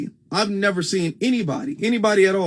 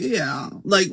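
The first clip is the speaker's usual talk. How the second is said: usual speaking voice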